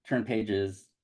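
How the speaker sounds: ordinary delivery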